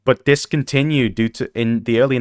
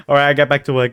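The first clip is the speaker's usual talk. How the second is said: ordinary delivery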